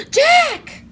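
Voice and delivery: loud high cry of alarm; alarmed shout. Acted. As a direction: shout his name in alarm. Annie cries out in alarm.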